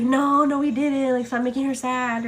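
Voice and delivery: insistent defending viewers. Retold or imitated.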